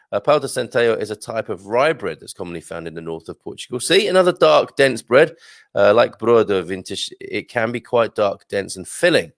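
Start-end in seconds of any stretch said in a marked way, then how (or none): none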